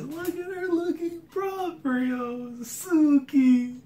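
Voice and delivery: high teary adoring gush; swooning fan squeal. Real, not acted. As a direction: melt over her look. Admiring character's look.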